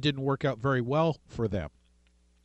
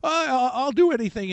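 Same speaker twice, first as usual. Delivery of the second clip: higher and louder, frantic pleading; desperate begging; 7 semitones higher, 4 dB louder than usual. Retold or imitated. Haman begs the queen for mercy, higher frantic pleading. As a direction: grovel at her feet, all panic. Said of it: "begging her".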